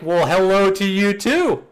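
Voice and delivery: high, loud sarcastic hello; acted-out bright retort, rising at the end; mock-cheery greeting. Acted reply to a cursing greeting.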